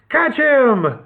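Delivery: high sharp command; urgent order. A narrator's reading. Robber chief orders his men after Nick, high and urgent. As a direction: send them after him.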